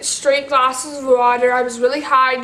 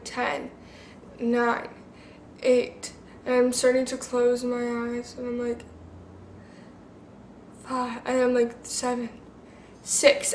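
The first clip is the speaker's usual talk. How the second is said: softer, fading count as sleep takes over; sleepy, softer numbers drifting off; drowsy countdown; 8 dB quieter than usual. Retold or imitated. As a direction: count down like you're going under, much softer. Counting down under anesthesia.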